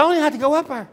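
emphatic high retort. Retold or imitated. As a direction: shoot the line up high and insist. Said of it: "I said".

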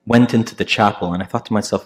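usual speaking voice

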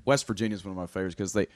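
ordinary talk

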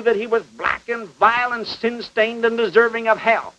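ordinary delivery

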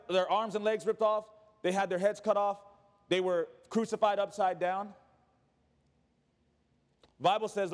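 usual speaking voice

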